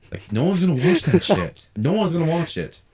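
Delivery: scoffing exclamation. Real, not acted. Mocking review channels to the host, loud. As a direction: scoff at the idea.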